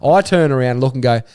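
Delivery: normal talking voice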